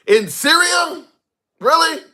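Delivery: incredulous high-pitched "really?"; ongelovig. Real, not acted. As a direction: scoff at the claim in disbelief. Mocking a viewer's claim.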